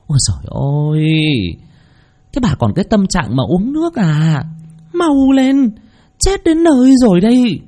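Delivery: high-pitched alarmed cry, climbing sharply; urgent, hurried neighbour. A narrator's reading. Neighbour rushing into the yard to warn old woman, urgent. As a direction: urge her to move, voice rising high in panic. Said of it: "Tiếng của bà Tư hối hả".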